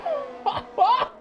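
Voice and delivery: very high, giddy cackle; excited high laugh. Real, not acted. Commentator nearing the final corner, giddy laughing squeal.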